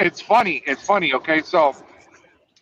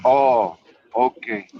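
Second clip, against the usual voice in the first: imitating the owner; 7 semitones lower than usual. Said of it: "the owner calls me".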